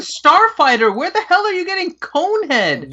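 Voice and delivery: high-pitched disbelief; incredulous demand. Real, not acted. Co-host questioning the cone head name, high incredulity. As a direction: demand an explanation, pitch shooting up.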